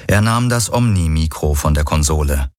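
usual speaking voice

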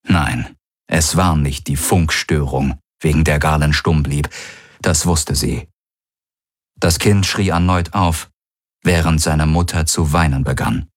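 plain reading voice

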